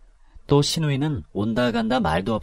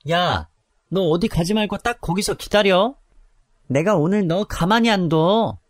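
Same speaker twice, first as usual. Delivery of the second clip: put-on drunken rage; 8 semitones higher than usual. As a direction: curse her out, slurring. Drunk threatening on phone. (술 취해 욕하는 목소리)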